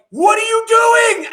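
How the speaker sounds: alarmed shout. Retold or imitated. Guest imitating the Orthodox family yelling at him, high and loud. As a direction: shriek it at the kid. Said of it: "They were like".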